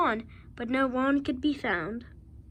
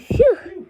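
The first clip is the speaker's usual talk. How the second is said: relieved phew; 5 semitones higher, 7 dB louder than usual. Acted. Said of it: "said the dog".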